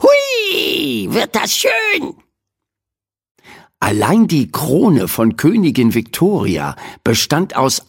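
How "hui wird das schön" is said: very high, fast, chirpy bird voice; squealing magpie. A narrator's reading. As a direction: squeal with glee like a tiny magpie. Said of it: "jauchzte sie".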